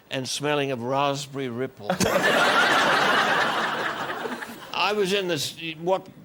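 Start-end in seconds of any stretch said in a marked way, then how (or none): 1.9-4.7 breathy rising laugh at his own story; amused real laughter; hearty laugh. Real, not acted. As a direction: let a laugh burst out at the memory.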